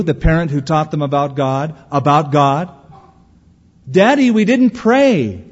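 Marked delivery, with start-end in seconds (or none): 4.0-5.4 put-on higher, slow, drawn-out child voice; slow singled-out reproach in a high kid voice; child reminding Daddy. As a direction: play a kid calling out a parent, louder and drawn out. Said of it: "your kids will sometimes remind you".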